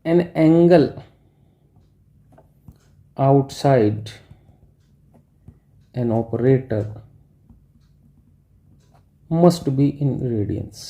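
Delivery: word by word, long pauses, like dictating to a class; slow dictation. Shown as a demonstration. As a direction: spell it out slowly so they can write. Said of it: "मैं एक statement लिख रहा हूँ". (धीरे-धीरे लिखवाते हुए, हर शब्द अलग)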